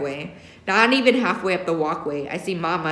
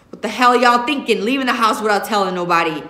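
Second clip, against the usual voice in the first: loud, fast angry father's yell; 3 semitones higher, 5 dB louder than usual. Acted. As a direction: tear into the kids for sneaking out. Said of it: "he yells".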